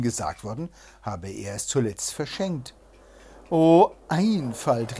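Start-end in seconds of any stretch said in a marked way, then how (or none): 3.5-5.0 loud, drawn-out reproach; crying out. A narrator's reading. Nina scolds her duped husband, crying out. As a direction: stretch the exclamation in exasperation. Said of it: "rief Nina".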